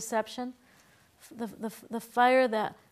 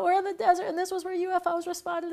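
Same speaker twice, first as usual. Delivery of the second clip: spooked Vata; 7 semitones higher than usual. Retold or imitated. Vata blaming spacemen for the breakdown, mock freaked out. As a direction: sell the spacemen theory wide-eyed. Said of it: "just kind of freaking out".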